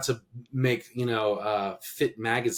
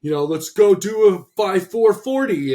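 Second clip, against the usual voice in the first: much louder, higher gung-ho jock; cocky younger self; 7 semitones higher, 9 dB louder than usual. Retold or imitated. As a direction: pump it up like a meathead who knows nothing else. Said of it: "'cause it's like".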